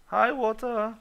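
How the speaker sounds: loud, high cutesy hello; silly greeting. Pretend. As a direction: greet it like a small friend.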